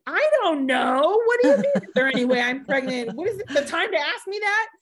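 high, loud, disbelieving retold protest; incredulous outburst. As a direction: blurt it out in shocked disbelief. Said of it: "And I was like".

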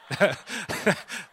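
high, loud laugh at himself; real burst of laughter. Real, not acted. Pastor laughing at his lavender story, real laugh.

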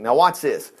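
ordinary talk, nothing marked

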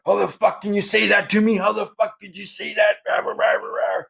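hammed-up furious yelling, then blah-blah rah noises; retold yelling. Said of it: "He's yelling".

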